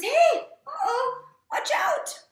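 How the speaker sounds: very high, loud, drawn-out cry; hammed-up alarmed storybook shout; urgent warning. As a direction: call out a warning to someone up high. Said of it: "he tries to warn him and he says".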